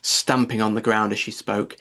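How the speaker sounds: ordinary delivery, nothing marked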